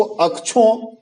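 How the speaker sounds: अक्षों read as "ak-chhon"; common misreading. Retold or imitated. Teacher showing how we read it, अक्षों as "akchhon". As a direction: put a chh into अक्षों. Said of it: "हम पढ़ते तो हैं उसको".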